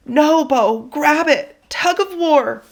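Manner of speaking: high panicked pleading. Acted. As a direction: beg in rising panic. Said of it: "My voice was high and panicked".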